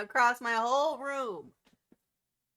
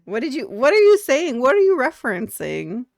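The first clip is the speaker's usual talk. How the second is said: louder, higher baffled questions; confused outburst; 4 semitones higher, 9 dB louder than usual. Real, not acted. Baffled by the boyfriend's Spanish, loud confused questions. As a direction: demand what he meant.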